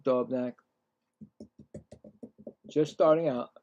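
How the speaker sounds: announcer voice for the at-bat; play-by-play announcer. Acted. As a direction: call each pitch like the broadcast booth. Calling batters and pitches.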